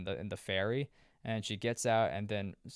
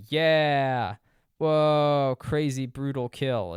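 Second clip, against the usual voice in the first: loud, higher mock-excited cheer; mock hype; 4 semitones higher, 8 dB louder, much slower than usual. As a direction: cheer it like a gorehound fan. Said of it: "it's not like you're like".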